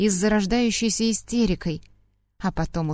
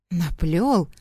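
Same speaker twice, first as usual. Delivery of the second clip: breathed disbelief; 2 semitones lower than usual. Acted. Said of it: "Выдыхаю я".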